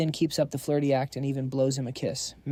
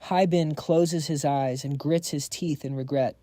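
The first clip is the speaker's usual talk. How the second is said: plain reading voice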